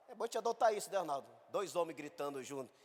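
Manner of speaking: ordinary talk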